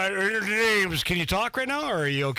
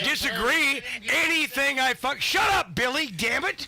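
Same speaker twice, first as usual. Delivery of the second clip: angry outburst; 3 semitones higher, slower than usual. Real, not acted. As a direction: cut him off in real anger. Brothers arguing on air.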